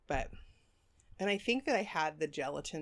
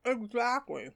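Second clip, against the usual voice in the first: mouth full; 7 semitones higher, 3 dB louder, slower than usual. Real, not acted. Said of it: "I'm sorry for talking with my mouth full".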